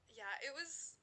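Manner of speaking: ordinary talk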